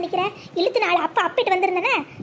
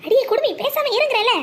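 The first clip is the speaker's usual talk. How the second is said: raised, higher scolding to shut her up; sharp hush; 5 semitones higher than usual. Acted. Scolding friend to keep quiet.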